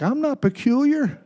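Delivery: a light imitation of a congregant's prim protest; mock offended denial. Mimicking a churchgoer who insists he's normal, lightly indignant. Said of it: "Some of y'all look at me like".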